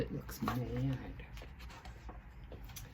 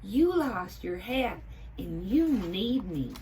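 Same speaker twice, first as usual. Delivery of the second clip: Hildy voice; 5 semitones higher, 5 dB louder than usual. A narrator's reading.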